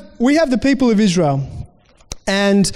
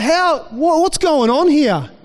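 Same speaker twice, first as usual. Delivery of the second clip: higher, slower indignant demand as the ticked-off king; angry king; 7 semitones higher, slower than usual. Retold or imitated. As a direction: play the furious king who can't believe it. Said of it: "He's like".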